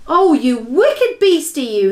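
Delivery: high, loud, slow scolding; angry cry. Acted. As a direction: scold the nightmare in the jar. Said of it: "he cried".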